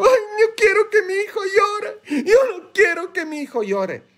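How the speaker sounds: very high, tearful put-on whine; exaggerated fake crying in a high voice; mock parental wailing. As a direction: wail like an overprotective parent, hammed-up. Mimicking parents who cannot bear crying.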